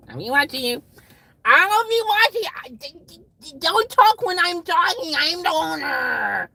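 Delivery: hammed-up high, loud shriek; imitated boss. Owner telling staff not to talk over him, mock screaming. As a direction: work up into a screeching mock boss tantrum. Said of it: "that's what the boss came in today".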